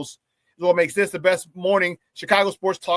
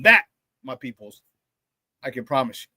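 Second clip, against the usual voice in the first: lower, deliberate promise; low solemn promise; 6 semitones lower than usual. Acted. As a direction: vouch for it gravely.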